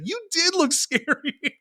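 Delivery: put-on high, drawn-out kid voice with a laugh; imitated son. Son admitting dad scared him, high laughing voice. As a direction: imitate a boy owning up, amused. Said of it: "he's like".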